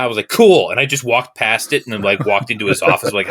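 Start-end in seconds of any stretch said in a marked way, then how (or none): 0.3-0.7 cocky cool. Retold or imitated. He strides past the desk.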